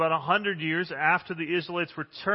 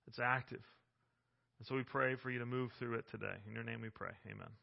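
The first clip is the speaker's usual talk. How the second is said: soft, reverent prayer voice; 6 semitones lower, 7 dB quieter than usual. Real, not acted. Pastor praying over the congregation, soft and reverent. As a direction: pray gently for the whole church.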